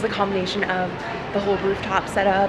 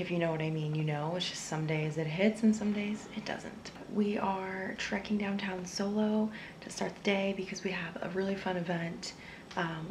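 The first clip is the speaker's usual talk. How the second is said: whispering; 14 dB quieter than usual. Real, not acted. Vlogger whispers in public.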